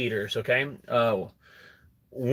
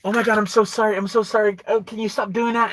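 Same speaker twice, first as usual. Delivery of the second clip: higher, flustered mock-woman voice; imitating her panic; 8 semitones higher, 3 dB louder than usual. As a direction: play her embarrassed and pleading, pitched up. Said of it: "And she goes".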